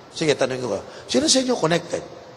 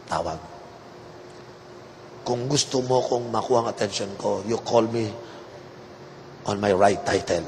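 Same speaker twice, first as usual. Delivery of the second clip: lower, softer, a lightly voiced lofty bishop; haughty bishop lecture; 7 semitones lower, 5 dB quieter than usual. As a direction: talk down like a self-important bishop, low. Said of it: "sabi niya". (mapagmataas na obispo, mas mababa at mahinang boses)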